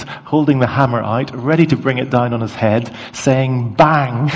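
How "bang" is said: playful mock bang, a little raised; toddler's bang. Toddler about to hit baby brother with toy hammer, mock bang. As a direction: lightly imitate a toddler's hammer blow. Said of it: "he went towards my youngest".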